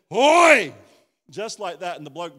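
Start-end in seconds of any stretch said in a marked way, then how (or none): 0.1-0.7 huge, high bellow across the water; a dad's furious yell; angry shout. Retold or imitated. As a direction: roar it to stop the man cold. Dad bursting in to rescue.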